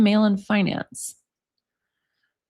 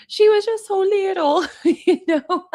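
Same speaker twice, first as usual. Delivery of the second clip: high, breathy mock mom impression; imitating a doting mom, much higher; 9 semitones higher than usual. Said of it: "was like".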